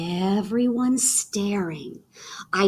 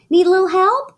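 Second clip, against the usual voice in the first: Mama offering help; 9 semitones higher, 6 dB louder, faster than usual. Acted.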